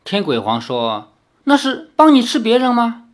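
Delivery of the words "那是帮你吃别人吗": eager question. Acted. Demon asks eagerly.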